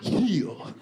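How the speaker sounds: a mother commands her son like a dog, sharp; mock dog command. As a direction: snap it like training a dog. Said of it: "she went".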